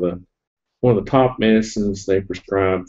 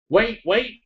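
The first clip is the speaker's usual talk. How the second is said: higher, mock-panicked wait; higher startled plea to stop; retold alarm; 12 semitones higher than usual. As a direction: imitate a sudden claustrophobic panic. Nose at the scanner hole.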